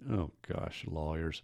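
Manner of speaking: muttered aside. Acted. Host breaking off the legal definition, exasperated muttered aside. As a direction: grumble it under your breath.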